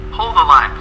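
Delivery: normal talking voice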